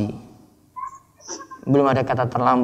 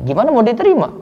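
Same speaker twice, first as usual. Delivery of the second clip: ordinary talk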